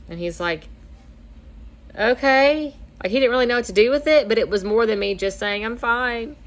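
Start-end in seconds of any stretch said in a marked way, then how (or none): 2.0-2.7 high-pitched, hesitant okay, imitating a husband; drawn-out, unsure okay, pitched up; baffled okay. Husband unsure what to do with crying wife, baffled mock okay. 5.6-6.4 higher, mock "I'm fine"; lightly imitated denial, pitched up; brushing it off. Crying pregnant wife fending off her husband, mock brush-off.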